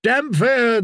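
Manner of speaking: high-pitched acclaiming call; crowd cry. A narrator's reading. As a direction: cry the name as a follower. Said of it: "they cried".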